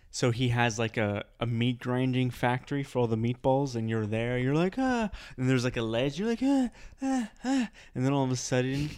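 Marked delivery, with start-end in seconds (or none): 4.8-5.1 short high cry of fake fright; high mock gasp. Victim's fright at the grinder. 6.4-7.7 short high cry of fake fright; high mock gasp. Victim teetering on a ledge.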